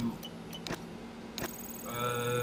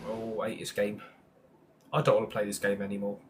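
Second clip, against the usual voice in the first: fed up; 6 semitones lower, 4 dB quieter, much faster than usual. Real, not acted. Fed up with the game.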